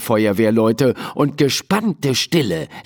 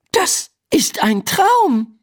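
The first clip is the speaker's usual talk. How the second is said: high, slow realisation; dawning realisation; slower than usual. Acted. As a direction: say it slowly as it dawns, pitched up. Realises it is a dream. (langsame, hohe Erkenntnis)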